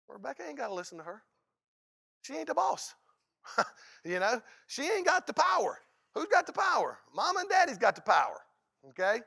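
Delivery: high, loud playful scolding voice; squeaky put-on parent laying down the law; mock bossy parent. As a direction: lay down the rules like a mock-stern parent. Mock parent speech about daughter.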